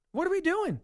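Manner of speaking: high-pitched exasperated question; incredulous. Real, not acted. Host reacting to the fundraiser passing its goal, incredulous. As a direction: pitch it up in sheer disbelief.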